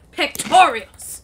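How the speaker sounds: pectorals said with an extra syllable, "pec-TOR-ee-als"; own pronunciation. Real, not acted. Showing off the muscles, pectorals said as "pec-tor-ee-als". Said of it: "That's the way I say it".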